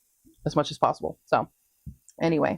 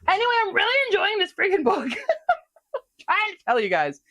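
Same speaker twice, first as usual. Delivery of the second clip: laughing through the words, higher and fast; 12 semitones higher than usual. Real, not acted. Telling viewers she loves the book, laughing. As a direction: gush while still laughing.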